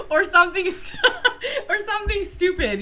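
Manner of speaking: high, loud laughter through the words; laughing. Real, not acted. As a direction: laugh it out as you talk. Laughing at her old excuses.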